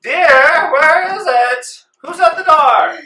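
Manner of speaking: very high, loud, slow grande dame voice; a shrill-pitched rich madam calling out; haughty lady. Acted. The rich lady calls to her maid in a high grand voice. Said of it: "the lady's like".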